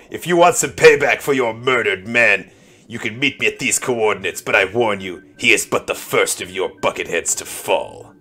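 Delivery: menacing masked-vigilante challenge; mock-villain promo threat, easing down at the end; Batman voice. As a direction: deliver a measured, hammed-up warning. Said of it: "rumbling with Batman voice".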